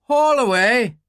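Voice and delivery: loud call up the well shaft; shouted order to haul on the rope. A narrator's reading. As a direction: call it out to the men above. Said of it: "he called".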